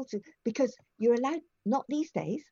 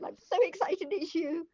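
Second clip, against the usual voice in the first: retold gush, pitched much higher; starstruck, squealing up high; excited; 10 semitones higher than usual. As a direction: blurt it out, thrilled to meet the Queen. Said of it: "I just said".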